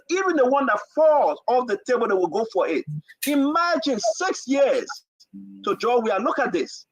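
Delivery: ordinary talk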